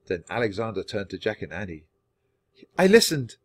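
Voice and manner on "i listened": high, loud, amazed realisation; raised, wondering voice; filled with wonder. A narrator's reading. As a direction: marvel at what just happened. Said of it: "in a voice filled with wonder".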